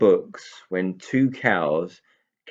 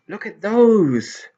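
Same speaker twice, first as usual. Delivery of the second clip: much higher, louder, slow delighted cry; joyful; 11 semitones higher, 10 dB louder, much slower than usual. Acted. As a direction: gasp at the treat. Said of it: "said Tigger joyfully".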